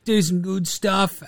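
lightly mimicked earnest athlete; athlete's interview answer. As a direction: recite modest sporting clichés. Imitating athlete's stock interview answer.

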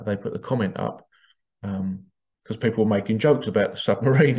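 ordinary delivery

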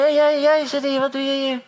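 a high, hammed-up call across the hall; single the boy out in front of everyone; mock leader calling out.

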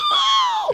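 sharp high gasp; shocked gasp. Retold or imitated. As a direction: gasp in fright. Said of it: "I'm like".

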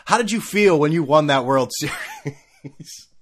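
slow overeager interviewer breaking into a laugh; drawn-out clumsy interviewer. Retold or imitated. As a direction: stretch the question out, laughing. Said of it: "Then he's just like".

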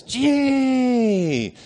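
high, loud shout held long; crowd cheer. Retold or imitated. Georgia fans answer the cheer, long loud yell. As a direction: yell the letter back like a crowd. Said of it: "And everybody goes".

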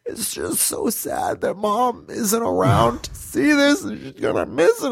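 high, slow, weepy mock sobbing; hammed-up tearful whine; imitated crying. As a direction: wail it like a tearful little sister. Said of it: "so my sister starts crying".

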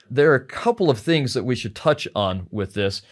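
normal talking voice

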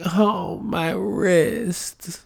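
high, drawn-out wail of pain; mock injured man. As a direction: imitate a fallen cyclist moaning. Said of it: "the dude is on the floor like".

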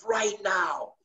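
high, slow mock yell; retold yell. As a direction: call her up the stairs, high-pitched and urgent. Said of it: "And I yelled".

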